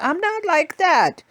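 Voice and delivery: high, slow and firm; firm grandma. Retold or imitated. Grandma tells her granddaughter she's not like that, firm and slow. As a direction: plant your feet, grandma won't be rushed. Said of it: "She said".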